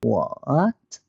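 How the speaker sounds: WHAT drawn out, dipping low then rising. Shown as a demonstration. Teacher shows Mandarin third tone, WHAT falling then rising. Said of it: "the third tone has a turn in it".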